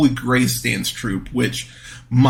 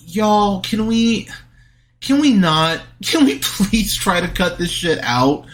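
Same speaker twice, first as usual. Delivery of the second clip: higher-pitched exasperated appeal, half laughing; pleading, pitched up in exasperation; exasperated plea; 7 semitones higher than usual. Real, not acted. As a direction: plead with them, fed up but amused.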